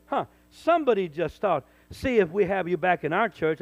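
loud, scornful quote of a threat; imitating a church board member, loudly; loud mock threat. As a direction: throw the board member's words back at him. Repeating a board member's thought.

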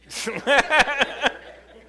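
big loud laugh, high; loud burst of laughter. Real, not acted. Laughs hard.

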